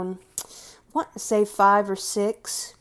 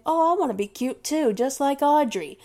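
higher, fast cutesy voice; 6 semitones higher than usual. Pretend. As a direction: try to sound cute like Audrey. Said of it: "try to do that voice".

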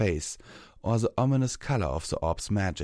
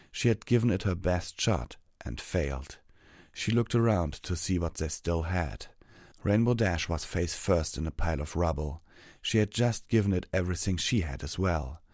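plain reading voice

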